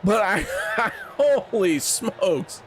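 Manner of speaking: slow laugh of disbelief. Real, not acted. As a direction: laugh it out, stunned.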